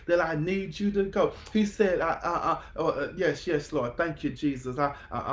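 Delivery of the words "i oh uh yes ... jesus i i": normal talking voice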